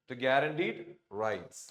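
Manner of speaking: slow, careful reading of the text line; slow read-aloud. Shown as a demonstration. Teacher reads the sentence for students, slowly. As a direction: lay each word down slowly for the learners.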